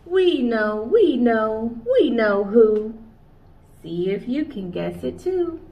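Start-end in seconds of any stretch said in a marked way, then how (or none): 0.1-2.9 much louder, proud announcement; triumphant chorus. Acted. Chorus of animals answers.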